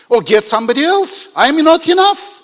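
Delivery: loud, high acted outburst; exclaiming in protest. Moses telling God to send another, loud protest. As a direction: cry it out, voice rising at the end.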